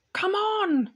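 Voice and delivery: high eager call; excited cry. A narrator's reading. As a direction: call them on, delighted. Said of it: "cried Mister Beaver".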